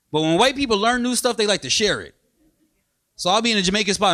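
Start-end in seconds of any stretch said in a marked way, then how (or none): none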